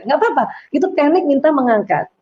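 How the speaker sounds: normal talking voice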